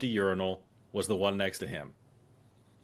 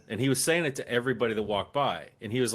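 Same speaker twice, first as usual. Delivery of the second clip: ordinary talk, nothing marked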